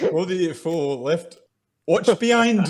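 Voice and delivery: high, hammed-up northern call; comically poor northern accent, building to a shout; bad northern accent. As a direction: shout it in terrible put-on northern English. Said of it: "like the worst Ant and Dec".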